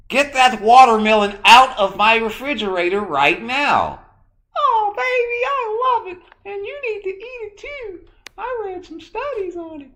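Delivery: very high, loud hammed-up voice. A husband scolding his wife about watermelon in a high mock voice. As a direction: bark orders like a fussy sitcom husband. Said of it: "act like that sometimes with my lady".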